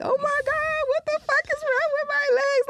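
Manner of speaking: very high, stretched-out mock wail; hammed-up freak-out in a squeal; mock panicked wail. Tripping, bent down on noodle legs, mock panic.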